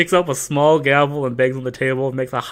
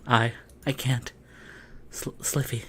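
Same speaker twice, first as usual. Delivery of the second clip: much softer, whispered; breathless Yuri; 5 semitones lower, 16 dB quieter than usual. Acted. Yuri overwhelmed beside Sliffy, breathy whisper. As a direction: barely get the name out.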